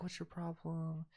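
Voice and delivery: slow, very soft, meek imitation; mock a timid man barely daring to challenge. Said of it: "He's, like, real timid and shit".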